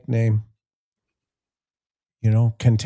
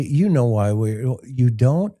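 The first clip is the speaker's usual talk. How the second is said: ordinary delivery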